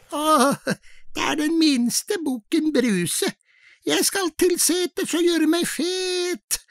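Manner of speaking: high, soft, thin little voice; thin little goat voice. Acted. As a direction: squeak it out like a tiny goat. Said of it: "Den var så tynn og fin i målet".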